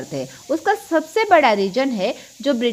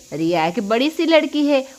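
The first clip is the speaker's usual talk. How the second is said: ordinary talk, nothing marked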